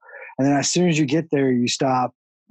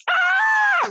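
mock shower wail. Friend mocking shower singers, a very high hammed-up wail. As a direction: belt out a silly shower wail. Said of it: "you start fucking wailing".

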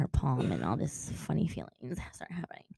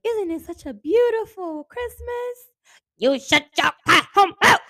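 high, slow put-on voice building louder; high theatrical imitation getting louder; mock character voice; 13 semitones higher, 7 dB louder than usual. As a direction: ham up a sugary voice that turns to a snap. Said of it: "they're saying Leon".

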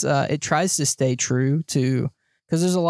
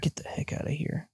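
whispered, much softer aside; hushed shooing; 2 semitones higher, 10 dB quieter than usual. Real, not acted. Co-host shooing a bee that visits mid-show, whispered. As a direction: whisper it to shoo off an intruder.

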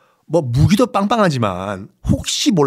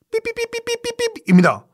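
bleep censored name. Acted.

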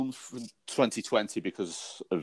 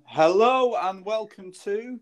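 drawn-out show intro; 9 semitones higher, slower than usual. Acted. Podcast opening greeting.

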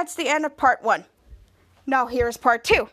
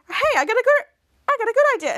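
excited idea; 9 semitones higher, 4 dB louder, faster than usual. Retold or imitated. Ray gets a sudden idea.

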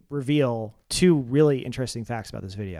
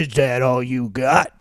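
slow, lower villain taunt; bad guy; 4 semitones lower, slower than usual. Bad guy repeating the challenge to the singer, slow villain voice. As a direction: put on a drawn-out bad-guy voice. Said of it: "I'm doing voices now too".

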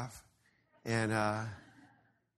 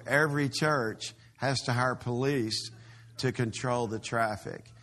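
ordinary talk, nothing marked

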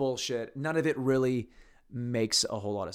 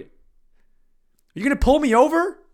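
mock arrest; 13 semitones higher, 11 dB louder, slower than usual. Pretending to be arrested.

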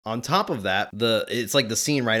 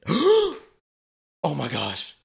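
shocked realization; 4 semitones higher, 4 dB louder, slower than usual. Retold or imitated. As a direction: gasp then slow, louder stunned "oh my gosh". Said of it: "and I went".

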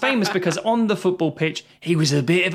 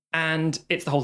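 ordinary talk, nothing marked

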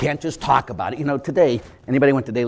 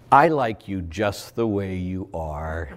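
slow, lower, syrupy acceptance; mock soothing coach; 5 semitones lower, much slower than usual. Coach indulging the player, slow mock tenderness. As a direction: draw it out tenderly, tongue in cheek.

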